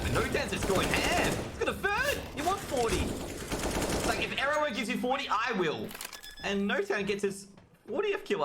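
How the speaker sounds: high-pitched, excited esports call; hyped-up play-by-play. Real, not acted. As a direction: ride the kill streak with a high, excited voice. Caster hyping a kill streak.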